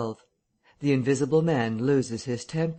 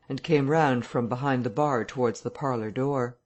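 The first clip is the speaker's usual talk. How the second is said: ordinary delivery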